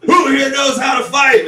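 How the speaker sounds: mock ring announcer shouting his question; hyped announcer. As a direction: pump up the fair crowd. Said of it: "and I'm quoting".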